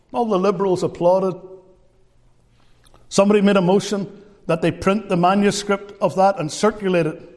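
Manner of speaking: normal talking voice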